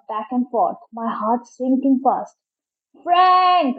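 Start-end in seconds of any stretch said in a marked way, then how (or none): 3.0-3.8 high-pitched searching call; shouted name. A narrator's reading. Joe searches the waves for his brother, high shout. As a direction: shout for someone out of sight. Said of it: "I shouted again".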